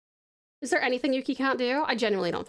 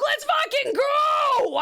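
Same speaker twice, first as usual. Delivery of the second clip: high, slow, shouted cheer; excited shout; 13 semitones higher, 5 dB louder, much slower than usual. Real, not acted. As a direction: yell it out with real joy. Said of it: "I'm so excited".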